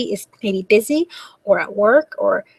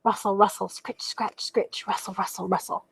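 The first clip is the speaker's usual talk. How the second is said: much softer, hushed scratching; soft rustling; 8 dB quieter than usual. A narrator's reading. Tiny noises in the dark tree, very soft. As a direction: barely breathe the rustles.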